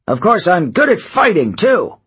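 puffed-up protest; indignant. Acted. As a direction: defend your toughness. Satyr bristles, glares.

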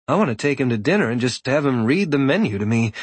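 ordinary delivery, nothing marked

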